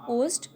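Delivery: "post" said alone as a word's last piece; isolated syllable. Shown as a demonstration. Teacher breaking decomposed into syllables, "post" spoken on its own. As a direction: separate "post" as a single syllable. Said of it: "is third syllable".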